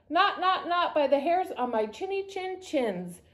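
high, stammering refusal; mild mock scream. A narrator's reading. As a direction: squeal it like a frightened pig. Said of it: "screamed Boris".

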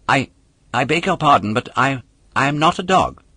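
a hint of a flustered wooden boy; flustered puppet. A narrator's reading. Puppet stammers to the polecat that he is no dog.

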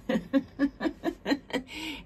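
short high real laugh after the joke; bright self-amused laugh. Real, not acted. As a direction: laugh at your own Southern quirk. Laughs at her own accent joke.

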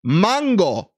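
"Mango" with an open Spanish "ah"; high, emphatic "MAHN-go"; Spanish pronunciation. Shown as a demonstration. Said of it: "That's how you say it".

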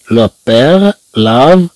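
very slow, drawn-out phrase; slow model reading. Shown as a demonstration. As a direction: stretch it for the pupils. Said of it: "teacher models slow French reading".

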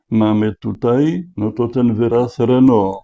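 ordinary talk

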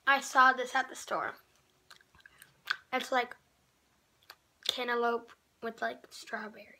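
gum in cheek; talking mid-chew; chewing gum while talking. Real, not acted. Seen 1: Blindfolded taste test; cheek bulge and jaw chewing while talking.

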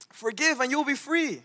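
high, put-on proclaiming voice; imitated preacher. Preacher exhorting a passive churchgoer, high-pitched mock proclamation.

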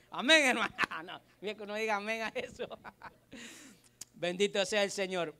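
a loud amen breaking into laughter; preacher laughing at his own machete joke. Real, not acted.

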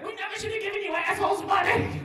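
high-pitched, full-volume comic tirade; outraged shout. Acted. Comic railing at a bailed-out bank's umbrella, outraged shout. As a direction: scream it in mock outrage. Mock outburst at a bank umbrella.

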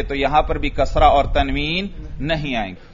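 usual speaking voice

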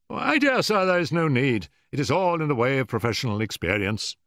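high, brisk reassurance; gracious Holmes. A narrator's reading. Holmes excuses the assistant's apology, graciously emphatic. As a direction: wave the apology away heartily.

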